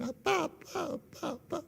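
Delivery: put-on high, soft little-girl voice; four-year-old daughter. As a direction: imitate a small child calling daddy, light and high. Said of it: "네 살 때 그냥". (네 살 아이 흉내 낸 높고 여린 목소리)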